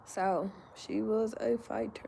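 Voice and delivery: hushed, breathless whisper. Real, not acted. Honoring a friend who passed, whispered. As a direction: barely voice it, full of grief. Remembering a deceased woman.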